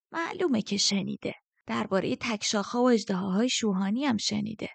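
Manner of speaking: put-on scornful old woman's sing-song; mocking. A narrator's reading. Old woman scoffs at his question, mocking. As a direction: sneer it with mockingly swooping pitch. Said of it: "باگرا با تمسخر گفت".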